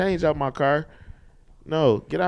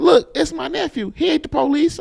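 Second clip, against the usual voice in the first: auntie voice; 10 semitones higher than usual. Retold or imitated.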